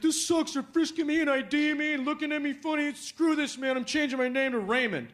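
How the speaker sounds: high, worked-up grievance; high-pitched, indignant griping; complaining cousin. Acted. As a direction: rant as a fed-up cousin on the phone. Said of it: "he calls me and he's like".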